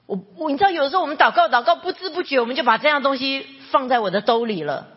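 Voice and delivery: fast, loud, high aside; excited rush. Real, not acted.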